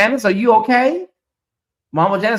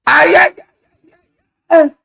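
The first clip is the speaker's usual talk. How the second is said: high wailing ay cries; 12 semitones higher, 9 dB louder than usual. Real, not acted. Wild cry during church moment.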